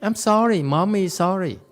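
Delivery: high, lightly voiced mother saying sorry; a hint of a contrite mother; mock apologizing mommy. Teacher imitates a mother apologizing to her child. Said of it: "No, you apologize".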